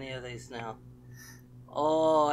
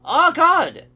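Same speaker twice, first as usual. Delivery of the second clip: high, very loud shriek; real frightened scream; 18 dB louder than usual. Real, not acted. Player spots her moving suddenly, frightened scream. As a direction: scream at a jump scare. Jump scare during horror game.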